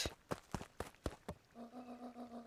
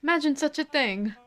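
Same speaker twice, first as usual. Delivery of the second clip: sarcastic; 5 semitones higher than usual. Acted. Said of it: "with a sarcastic tone".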